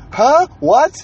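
loud, high-pitched mock "huh?"; puzzled old geezer. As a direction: imitate an old man who didn't catch it. Old veteran fails to hear question.

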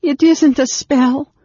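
high, loud, drawn-out protest; despairing cry. A narrator's reading. Anne Beryl denying the spell to Raistlin, crying despairingly. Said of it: "Anne Beryl cried despairingly".

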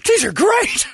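high, loud mock enthusiastic voice; imitating Brady. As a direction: squeal it like a fake-happy fan. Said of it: "Brady'd be in that car going".

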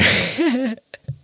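a burst of high squeaky laughter; high-pitched laugh. Real, not acted. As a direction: let out a sharp, high laugh. Laughing at a racist tweet.

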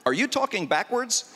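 normal talking voice

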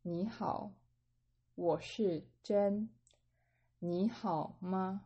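slow, careful Mandarin dialogue line; slow model reading. Shown as a demonstration. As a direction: say each word slowly and clearly for learners. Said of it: "So again".

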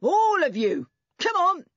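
loud, high, impatient bellow; roaring. A narrator's reading. Man hurrying children out of the water, roaring. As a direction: bellow each order in a burst. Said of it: "roared the man".

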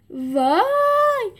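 high, stretched cry of fright; frightened yelp. A narrator's reading. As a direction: shriek it in terror. (جیغ کشیده و زیر از ترس)

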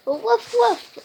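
high, loud woof woof; yappy puppy barks; barking dog. Acted. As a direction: bark like a little puppy. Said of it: "barked Woody".

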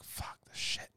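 whispered curse under the breath; hushed, defeated swearing; muttered exasperation. Retold or imitated. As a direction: whisper it, worn down and fed up. Venting quietly about his injuries.

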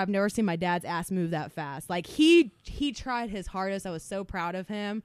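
ordinary talk

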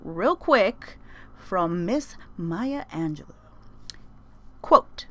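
slow, deliberate lead-in; slow announcement. Real, not acted. Introducing a Maya Angelou quote, slowly. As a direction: draw it out for effect.

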